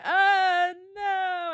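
high, loud drawn-out cry of dismay; mock anguished wail. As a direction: wail it like a hammed-up heartbroken heroine. Acting out Marianne hearing bad news.

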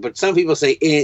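usual speaking voice

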